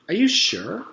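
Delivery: high, sweet dentist voice; a sugary, sinister dentist. A narrator's reading. Dentist voice in story.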